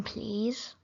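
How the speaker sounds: ordinary talk, nothing marked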